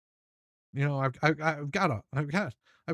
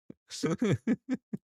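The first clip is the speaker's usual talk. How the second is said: breathy giggle at own joke; real chuckle; 5 semitones higher than usual. Real, not acted. Host cracking up at own hand job joke, chuckling. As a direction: chuckle to yourself.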